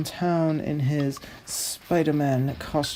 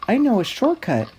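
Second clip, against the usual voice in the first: higher, fast eager line; quick character voice; 8 semitones higher, 4 dB louder, much faster than usual. Acted. Extra two boasting to his buddy, eager and quick. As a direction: blurt it out like a cocky driver.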